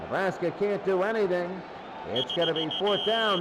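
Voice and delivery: ordinary talk, nothing marked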